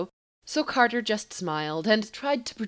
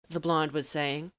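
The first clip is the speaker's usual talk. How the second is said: ordinary delivery, nothing marked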